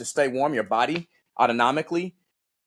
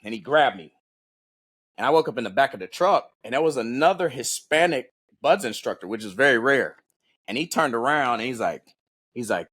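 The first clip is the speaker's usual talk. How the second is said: ordinary talk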